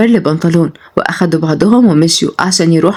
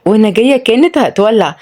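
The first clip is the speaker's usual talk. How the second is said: ordinary delivery, nothing marked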